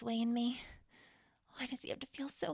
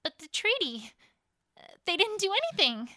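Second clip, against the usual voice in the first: much higher and louder, rushing out the words; alarmed disbelief, the words tumbling faster; shocked protest; 9 semitones higher, 8 dB louder than usual. Acted. Hears news of the invasion.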